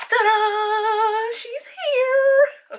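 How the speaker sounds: high, loud, squealing reveal; excited reveal. Real, not acted. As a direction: announce it with a squeal of joy. Reveals palette.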